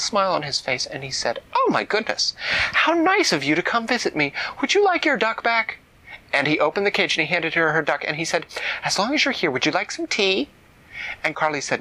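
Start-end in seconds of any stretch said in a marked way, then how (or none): 1.5-5.8 put-on high, sugary friendly old man; sweet sorcerer. A narrator's reading. Sorcerer suddenly turns nice. 8.8-10.5 put-on high, sugary friendly old man; sweet sorcerer. A narrator's reading. Sorcerer offers tea.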